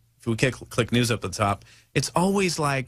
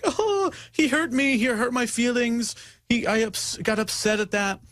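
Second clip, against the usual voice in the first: mock whiny crybaby; 10 semitones higher than usual. Mocking people who cry over hurt feelings. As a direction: whine like a hurt little child.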